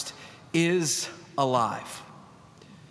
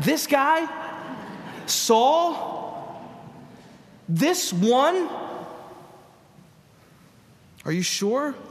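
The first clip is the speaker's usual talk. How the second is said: put-on high incredulous Philly accent; a wiseguy from Philly, doubting; 8 semitones higher, much slower than usual. Ananias questioning God, in a Philly accent. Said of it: "Probably in a Philly accent".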